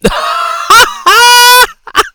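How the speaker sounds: loud squealing laugh bursting out; a screaming cackle of delight; high shrieking laugh. Real, not acted. Laughs after the quote.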